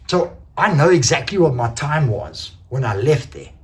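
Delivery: ordinary talk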